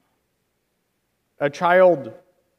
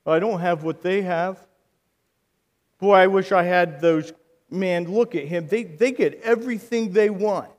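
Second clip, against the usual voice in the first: normal talking voice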